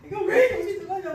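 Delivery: high, loud pretend wail; loud mock-crying protest; mock tearful complaint. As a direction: complain as if praying through tears, hammed-up. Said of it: "막 울면서 하겠지".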